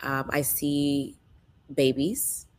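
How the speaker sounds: normal talking voice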